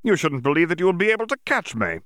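quick, high, scornful retort; snorting defiance. Acted. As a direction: toss it back fast and mocking. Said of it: "Yaxley snorted".

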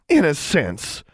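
high, sharp reproach; scolding. A narrator's reading. Sister brandishing her wand, scolding. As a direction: call the name in rebuke. Sister rebukes rude girl.

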